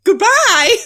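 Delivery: high, trilling posh goodbye; posh lady voice. Acted. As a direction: chirp it like a socialite. Posh character says goodbye.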